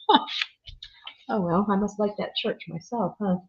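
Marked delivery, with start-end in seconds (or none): none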